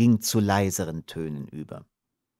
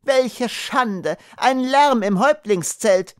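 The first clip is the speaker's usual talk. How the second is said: higher aghast cry; dismayed outcry; 12 semitones higher, slower than usual. Acted. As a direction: wail over the disgrace. Unschida wails in alarm.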